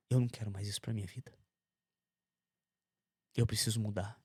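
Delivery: a soft, resigned resolve; quiet vow. Retold or imitated. Telling God he wants to change, soft resolve. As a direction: say it softly, as if to yourself.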